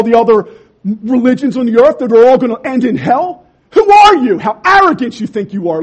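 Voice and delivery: building into an outraged shout; mock scolding critic. Preacher voicing critics who shout down the believer, outraged.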